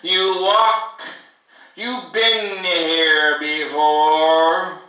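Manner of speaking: loud, declaimed poetry recital; incantatory poem at full voice; poem reading. Acted. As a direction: project each phrase forcefully, pausing between them. Reads a second poem aloud.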